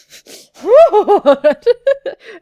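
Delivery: big high whoop of laughter; real laughing outburst; loud laugh. Real, not acted.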